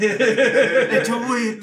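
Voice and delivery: high, very slow, laughing mock French sigh; a tragic French poet, laughing; French accent. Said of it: "so it's in a French accent".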